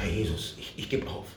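low, soft, breaking into a whisper; giving up in prayer. Retold or imitated. Kneeling in the bedroom, giving up to Jesus in a whisper. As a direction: surrender barely breathed out. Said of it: "zu den Herren geschrien".

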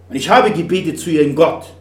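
hoch und laut, abweisend; stoß ihn verzweifelt weg; verzweifelter Mann. Acted. Trauernder Vater klagt Gott an. (der Mann an der Klippe wehrt den Christen laut ab)

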